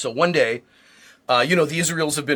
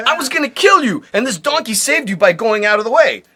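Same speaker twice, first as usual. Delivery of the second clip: scolding angel; 7 semitones higher, 5 dB louder than usual. Acted.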